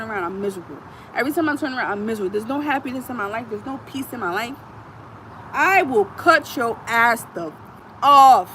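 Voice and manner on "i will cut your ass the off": slow threat, last word shouted loud. Real, not acted. Warning people who cross her, slow then loud. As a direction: drag it then bark the end.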